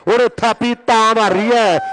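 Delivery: usual speaking voice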